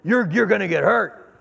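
a lightly voiced stern officer warning; gruff warning. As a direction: warn him off, half-joking but firm. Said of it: "he'd say to me".

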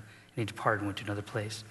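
ordinary delivery

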